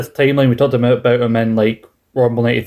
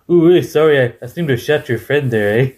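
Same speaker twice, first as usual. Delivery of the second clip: Stu Hart impression. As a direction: play the old Canadian who shot your friend by accident. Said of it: "You sounded like Stu Hartwell".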